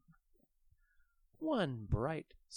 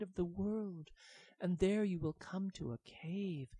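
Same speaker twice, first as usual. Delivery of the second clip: wise little dragon; 6 semitones higher than usual. Acted. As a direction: tell it like a solemn secret. Baby dragon instructs.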